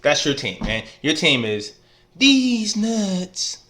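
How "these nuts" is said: high, squeaky comic voice on these nuts; squeaky punchline. Acted. Telling his rival his team is these nuts, high and squeaky. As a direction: pop it up high and silly like a punchline.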